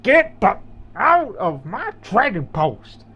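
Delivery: put-on loud, high and slow, like a grumpy old trader; angry trader shout. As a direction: kick them out of his post, hammed-up. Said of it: "he's like".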